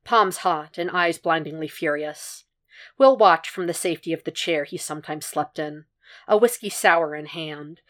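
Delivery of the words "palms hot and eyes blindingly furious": ordinary delivery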